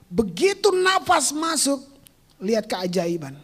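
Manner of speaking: usual speaking voice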